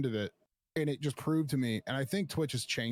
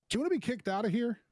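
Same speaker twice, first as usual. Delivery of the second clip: mock guard threat; 11 semitones higher, faster than usual. Security guard threatening to kick him out, higher fast mock snap. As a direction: throw out a quick, high, mock threat.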